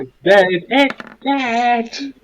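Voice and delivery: held-back yell, rising high; mock yell. Player's character calling to the party, mock yell. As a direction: call it out high without real volume. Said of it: "I just yelled out".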